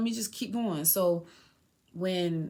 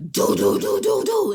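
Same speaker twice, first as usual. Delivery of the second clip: imitated knocking; 13 semitones higher, 10 dB louder than usual. Imitating noise from truck back.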